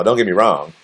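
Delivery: usual speaking voice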